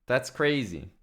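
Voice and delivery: ordinary delivery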